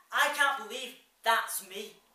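loud, slow cry of disbelief; emphatic disbelief. Acted. Man at the mirror disowns his reflection, loud and slow. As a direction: drag it out loudly, stunned.

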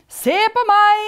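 high, very loud boast; showing off. A narrator's reading. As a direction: shout for everyone's attention. Spirit shows off with the crown.

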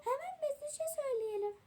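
high-pitched mock urgency; acted alarm. Shown as a demonstration. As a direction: demonstrate an alarmed decision. Showing sibling the line to act.

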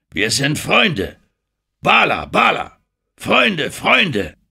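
langsam beginnend, dann hoch gerufen; laute Beteuerung. Acted. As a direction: beteuere dem Häuptling laut die Freundschaft. Engelhorn beteuert dem König Freundschaft. (eindringlich und laut rufend)

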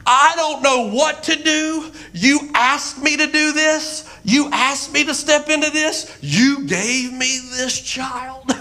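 high, pleading protest to God; impassioned acted-out prayer; desperate prayer. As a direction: plead upward, overwhelmed and exasperated. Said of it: "say one of my prayers".

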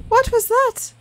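jumpy clown hears a noise; squeaky alarmed question; high-pitched startled clown. Acted. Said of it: "Trying to give him, like, a high-pitched voice".

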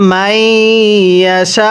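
মাইয়াশা recited slowly, ending on silent শা without the vowel; pausal form. Shown as a demonstration. Teacher showing how to stop at the verse, slow recitation. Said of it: "ওয়াকফ করলে কিভাবে পড়ব?".

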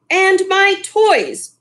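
put-on high, slow whine of a spoiled boy; whiny spoiled son; whining. A narrator's reading. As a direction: drag it out like a sulky child. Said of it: "whined the boy".

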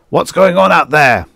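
loud, high bellow. A narrator's reading. General yelling out at whoever is outside, bellowing. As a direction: bellow it out as a demand. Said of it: "he bellowed".

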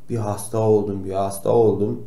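ordinary talk

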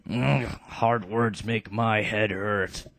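slow, groaning thick-headed brute; slow dim brute. Acted. Bartray complaining, slow dim brute voice. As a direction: drag it out like it hurts to think. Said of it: "the stupid character".

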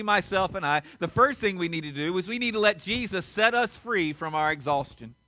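ordinary talk

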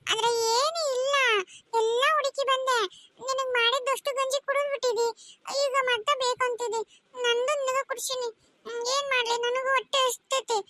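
put-on high-pitched, plaintive child's lament; tearful helplessness. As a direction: plead helplessly, close to tears. Hungry child frets over crying sibling. (ಅಳುಮುಖದ ಅಸಹಾಯಕ ದನಿ)

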